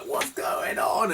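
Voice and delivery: very slow, high mimicked wail; high, stretched-out imitation of her; older woman. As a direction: draw it out like a baffled older woman. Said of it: "I guess she was, like, fifty, sixty".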